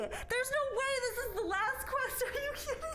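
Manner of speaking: overwhelmed with joy, half laughing half crying. Real, not acted. Streamer reaching the last quest, tearful excited squealing. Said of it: "Why am I crying?".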